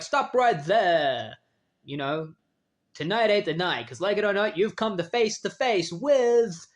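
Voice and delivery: heroic superhero challenge. Retold or imitated. Hero's speech to burglars.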